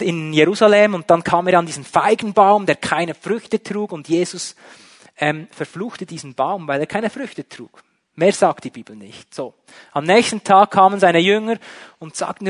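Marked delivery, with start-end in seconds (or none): none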